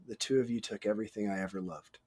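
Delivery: low, very soft and quick; subtle, under-breath delivery. Retold or imitated. Retelling a film's best quote, subtle and under the breath. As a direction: say it quietly, almost to yourself. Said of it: "the subtlety of him going".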